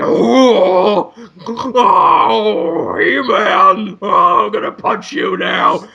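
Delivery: groaning cartoon beast henchman; Beastman. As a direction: howl it out like a lumbering beast. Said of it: "there's Beastman going".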